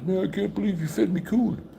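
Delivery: high-pitched, indignant mock complaint; pissed-off buddy. Buddy griping to his host about being fed coon, pissed off. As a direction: imitate an outraged friend, voice pushed up high. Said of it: "all mad and pissed off at me".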